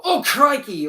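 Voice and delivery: loud, high burst of surprise; mock Australian exclamation. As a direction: exclaim it like a caricatured Aussie. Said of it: "it's mainly Australian, and they go".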